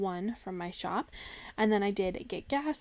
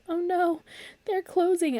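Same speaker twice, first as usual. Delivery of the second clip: high, loud "oh no" of shock; dismayed surprise; 9 semitones higher, 5 dB louder, slower than usual. Retold or imitated. Reading the shop's email, a high loud dismayed cry. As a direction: gasp it like bad news just landed. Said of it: "and I'm like".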